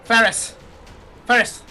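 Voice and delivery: loud, high summoning call; captain calling someone over. Acted. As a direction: holler for a crew member. Captain character calls for crew member.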